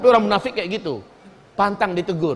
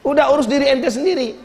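mock offended hypocrite; 6 semitones higher than usual. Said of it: "Lalu apa katanya?".

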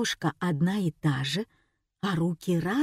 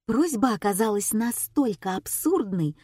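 usual speaking voice

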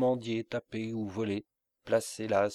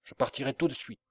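usual speaking voice

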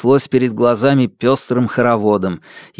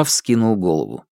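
ordinary delivery, nothing marked

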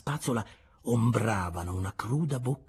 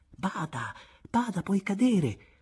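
motherly warning; 9 semitones higher, slower than usual. A narrator's reading. Mother to child leaning from the carriage, mildly urgent warning. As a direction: caution the child, slowing and lifting the second call.

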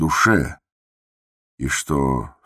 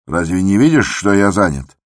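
loud, higher scolding; impatient raised-voice snap; irritated shout; 4 semitones higher, 6 dB louder than usual. A narrator's reading. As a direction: rebuke someone who interrupts you. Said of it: "крикнул он бабе".

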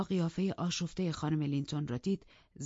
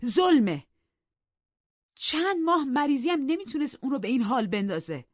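louder, high indignant blame; reproachful master; 6 semitones higher, 6 dB louder than usual. Acted. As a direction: accuse the servant of hiding it. Edgar blames the servant.

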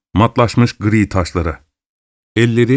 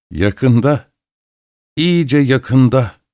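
slow, drawn-out words with a grin; lightly acted, slow grinning anticipation; 3 semitones higher, much slower than usual. A narrator's reading. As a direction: stretch it out through a sly grin. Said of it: "diye sırıttı Miss Rashleigh".

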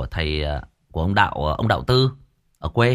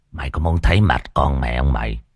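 lower, rough gangster threat, lightly voiced; Long Mộng Gà catchphrase; 6 semitones lower, 4 dB louder than usual. Storyteller quotes his idol's famous line in a low hoarse voice. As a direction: growl the threat like a hoarse gang boss. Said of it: "đúng câu nói quen thuộc của anh Long".

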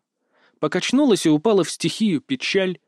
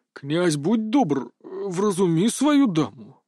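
slow, drawn-out, somewhat bewildered plea; puzzled appeal; 3 semitones higher, slower than usual. A narrator's reading. Baffled Supreme mage asks the prince to calm his lady, puzzled and slow. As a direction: stretch the words out in mild bafflement. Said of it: "несколько озадаченно обратился к нему Верховный".

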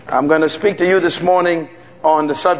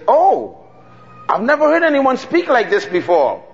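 put-on higher, amazed voice of a young woman; woman on the plane marvels at the preacher, higher and amazed; 7 semitones higher than usual. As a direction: act out her astonishment.

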